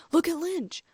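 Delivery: high, loud call to look; excited shout. A narrator's reading. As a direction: shout it out to friends in the stands. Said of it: "I heard Harry shout".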